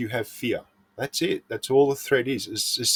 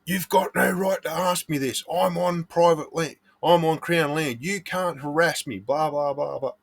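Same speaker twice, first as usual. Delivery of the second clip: higher, huffy imitation of a defensive prospector; mock indignant protest; 6 semitones higher than usual. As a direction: play the touchy guy getting his back up, hammed up. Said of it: "gets his back up immediately".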